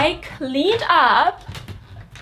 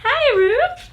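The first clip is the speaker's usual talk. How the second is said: mock high voice; 13 semitones higher than usual. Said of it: "And I was just like".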